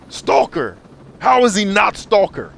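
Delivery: real disbelief over the casting; indignant outburst. Real, not acted. Streamer venting to chat about the movie, indignant. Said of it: "outraged about G I Joe casting".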